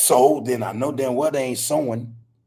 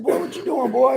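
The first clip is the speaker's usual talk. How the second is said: put-on pitched way up, playful coo to a baby; baby-talk voice; 12 semitones higher, 3 dB louder than usual. Grandfather talking to baby grandson on the phone, cooing high.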